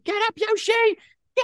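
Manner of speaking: very high-pitched imitation of a player; mock pleading yell. Host imitating guest urging Yoshi up, high mock yell. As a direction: squeal it as a teasing impression.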